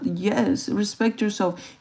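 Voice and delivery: ordinary delivery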